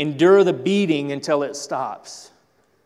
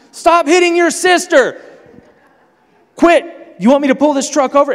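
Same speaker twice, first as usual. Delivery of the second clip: high, loud furious parent shout; mock angry yell; 12 semitones higher, 10 dB louder than usual. Angry parent yelling at the kids in the truck. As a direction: yell at the kids from the driver's seat.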